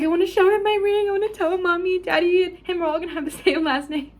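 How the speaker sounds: put-on high, eager little-girl voice; childlike excitement. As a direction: beg to go home like an excited child. Childlike excited retold quote.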